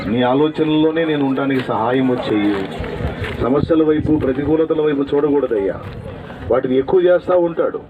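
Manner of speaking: loud pleading prayer over the congregation; earnest, loud prayer voice; fervent prayer. Acted. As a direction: cry out to God on everyone's behalf. Leads prayer aloud.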